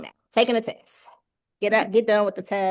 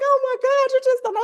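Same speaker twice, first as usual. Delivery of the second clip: crying; 4 dB louder than usual. Retold or imitated. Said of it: "I just started crying".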